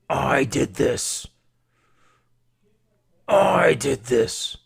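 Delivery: slow, booming, distorted-sounding roar; loud, deliberate villainous gloating; roared villain confession. Acted. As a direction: bellow each word, drawn out and building.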